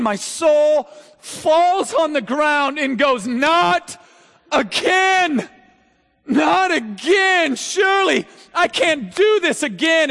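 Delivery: high, loud, slow shout of despair; a broken soul crying out; anguished cry. Acted. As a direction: wail not again from the depths. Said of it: "He's saying".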